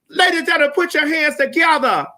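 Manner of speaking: rising hype announcer build, fall on pastor; announcer intro. Acted. Host announcing the cussing pastor to the crowd, hype announcer voice. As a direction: introduce him like a hype man. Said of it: "without further ado, the man of the hour".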